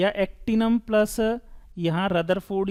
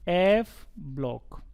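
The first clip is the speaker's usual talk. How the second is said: एफ block drawn out slowly, each word spelled out; teacher stretching एफ block at half speed; slow emphatic answer; 3 semitones lower, much slower than usual. Shown as a demonstration. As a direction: say एफ block slowly so the class can write it down. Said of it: "इनको क्या बोलते हैं".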